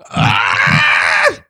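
sudden high yelp of shock; sharp shriek. Real, not acted. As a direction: let out a startled high cry. Sudden outburst at mirror.

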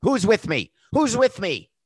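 high-pitched rallying call from a sinking ship; Florentino Pérez. Acted. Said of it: "like the last guy in the Titanic".

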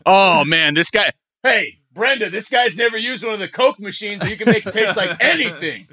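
mock sinister shop worker. Voicing froyo staff kidnapping tourist.

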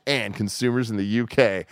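normal talking voice

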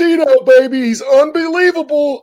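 loud, high-pitched hammed-up sportscaster impression; Dick Vitale. Radio host gushing over Iona as a famous announcer, hammed-up shouting impression. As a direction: holler it like an over-the-top basketball announcer. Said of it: "was that supposed to be Dick Vitale?".

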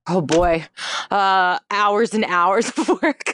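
real laughter breaking into speech; amused laughing. Real, not acted. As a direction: laugh through your own joke. Joking answer, laughing as she talks.

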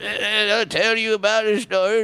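high trembling grandpa voice; trembling old man voice. Acted. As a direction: quaver like an old grandpa telling a story. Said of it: "your old man voice".

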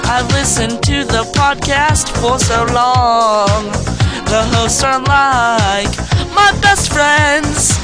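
ordinary talk

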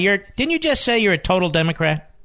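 ordinary talk

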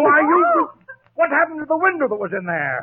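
furious outburst. Acted. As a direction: explode at the culprit. Furious at the damage.

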